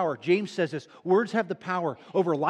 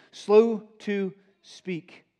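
"Slow to speak" very slow and louder; stress on slow; 3 semitones higher, 6 dB louder, much slower than usual. Shown as a demonstration. Preacher driving home slow to speak, slow and loud. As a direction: lean hard on "Slow", drawn out.

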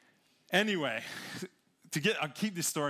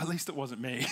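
said through a laugh; wife's cheeky joke, laughing; 13 semitones higher than usual. Retold or imitated. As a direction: toss it off with a giggle. Wife's joking reply.

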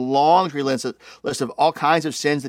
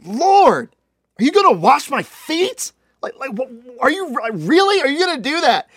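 high, loud disbelieving protest; can't believe his Lord would stoop to this; incredulous Peter; 10 semitones higher, 5 dB louder than usual. Acted. Peter balks at foot washing.

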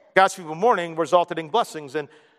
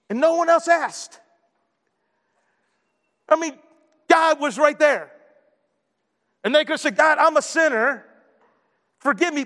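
high, ringing pulpit voice; fervent preacher, pitched high and insistent; preaching at full force; 9 semitones higher than usual. Acted. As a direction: drive it home to the congregation.

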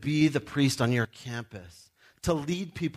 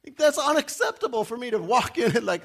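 ordinary talk, nothing marked

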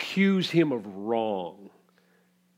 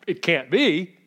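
scoffing disbelief; 4 semitones higher, 8 dB louder than usual. Acted.

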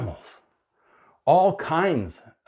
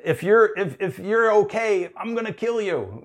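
high, half-shouted mock parental panic; frantic relieved parent; 9 semitones higher than usual. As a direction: play the parent torn between relief and fury. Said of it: "when they were like".

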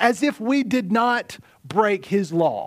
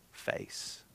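breathy whisper on the single word; 19 dB quieter than usual. Acted. Preacher whispering the word face to the congregation. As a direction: drop to a hush to land the word.

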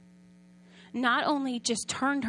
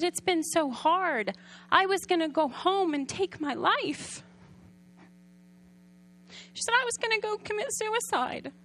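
desperate confession; 6 semitones higher than usual. Retold or imitated. Mother confessing despair.

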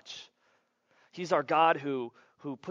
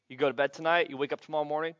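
ordinary talk, nothing marked

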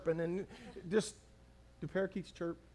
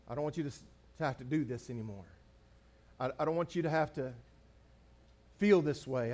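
softer, gentle promise to his wife; tender reassurance; 4 semitones lower, 6 dB quieter, faster than usual. Retold or imitated. Husband comforting his wife about the finances, soft and tender. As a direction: soothe her, quietly taking the burden. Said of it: "I said, I said".

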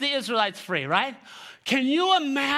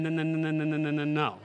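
low stammered string of refusals; mock stutter, dropping low; 6 semitones lower, 3 dB quieter than usual. Imitating Moses making his excuses, stuttering. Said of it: "he had issues stuttering".

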